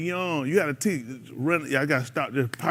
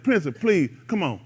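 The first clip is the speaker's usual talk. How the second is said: normal talking voice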